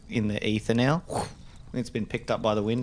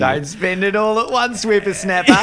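put-on high, loud old-man voice; old codger voice; 9 semitones higher, 7 dB louder than usual. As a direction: play a fussy old-timer. Co-host voices old-timer.

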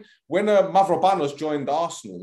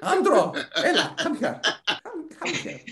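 put-on high Greek-accented mum calling her son; imagined Greek mum; 12 semitones higher than usual. Imagining Townsend's mum calling him, high Greek mum accent. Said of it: "I can't imagine his mum talking like that".

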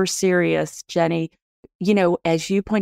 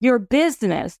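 ordinary delivery

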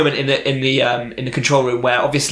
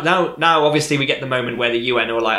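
ordinary talk, nothing marked

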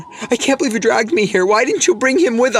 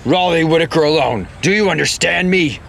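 forcing a deep voice; 4 semitones lower than usual. Acted. Disguised hero confronts bully.

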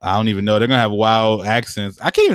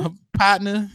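higher, laughing put-on Southern twang; mock Southern accent; 8 semitones higher than usual. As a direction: toss off a cowboy partner. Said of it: "Southern ass accent".

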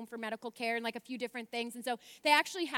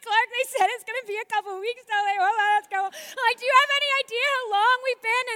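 loud, much higher, frantic; frantic pleading; 11 semitones higher, 12 dB louder than usual. Retold or imitated. Frantically telling her husband and pleading with embassy staff.

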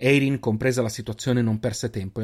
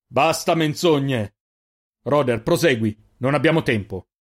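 loud furious order; louder, hammer out basta menzogne slowly; angry cut-off; 2 semitones higher, 4 dB louder than usual. Acted. Jofix silences the keeper, furious loud order.

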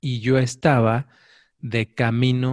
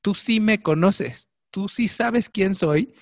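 firm higher reasoning with a touch of exasperation; amused and annoyed; 7 semitones higher, 3 dB louder than usual. Retold or imitated. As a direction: lay it out deliberately, half laughing half cross. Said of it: "me dio mucha risa y a la vez coraje".

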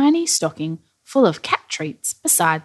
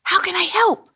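high, eager little voice; Billy the Bathtub; 12 semitones higher than usual. A narrator's reading. Billy offers Santa help, high and eager. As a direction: pipe up, keen to help. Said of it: "asked Billy immediately".

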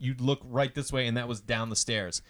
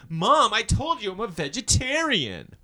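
higher, slower exaggerated teenage complaint; dramatic teen whine; 9 semitones higher, 4 dB louder, slower than usual. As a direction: overplay the fed-up daughter. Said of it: "she wasn't that dramatic".

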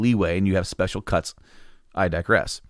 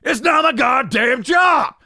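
slow, furious shout, much higher and louder; angry shout; 6 dB louder, slower than usual. Retold or imitated. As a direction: bellow it like a fed-up worker who has had enough. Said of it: "It's like".

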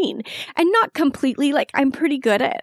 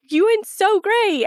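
higher, slow proud praise for her; praising husband; 11 semitones higher, slower than usual. Retold or imitated. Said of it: "He was like".

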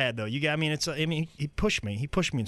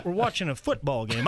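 ordinary talk

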